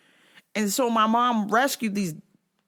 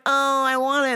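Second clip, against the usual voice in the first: cooing; 6 semitones higher than usual. Retold or imitated. Owner seeing a cute dog, cooing. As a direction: melt over a cute dog. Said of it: "when I see a cute dog, I'm like".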